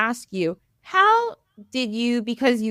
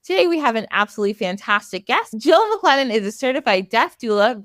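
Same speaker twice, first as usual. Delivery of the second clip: usual speaking voice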